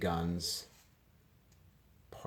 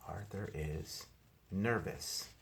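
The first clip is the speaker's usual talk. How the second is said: ordinary delivery, nothing marked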